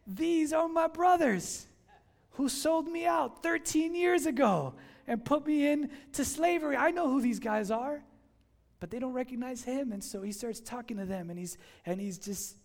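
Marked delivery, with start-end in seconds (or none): 0.1-8.0 raised, slow, louder realization; Joseph realizing. Retold or imitated. Joseph recognizing the brothers who sold him, slow and raised. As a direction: spell out the betrayal, slowly and loud.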